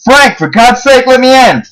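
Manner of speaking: high, drawn-out urgent cry; yelling out. A narrator's reading. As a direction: yell as a woman pleading at the door. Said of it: "I heard a woman yell out".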